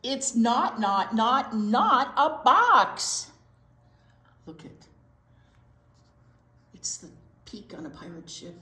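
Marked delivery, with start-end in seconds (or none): none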